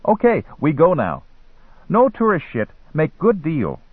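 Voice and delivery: high-pitched eager hustler; young guide in broken English. A narrator's reading. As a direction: seal the deal like a street boy.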